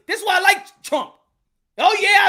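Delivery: high, loud, unapologetic proclamation; loud defiant admission. Real, not acted. Host admits he likes Trump, loud defiance. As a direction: announce it boldly, daring anyone to object.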